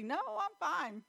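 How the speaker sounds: lightly voiced child denying she's hurt; child's fib. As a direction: play the kid pretending nothing's wrong. Bleeding child brushing it off.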